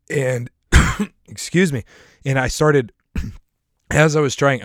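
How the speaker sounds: talking through a cough; hoarse, breaking off to cough. Real, not acted. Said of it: "see, I'm coughing right now".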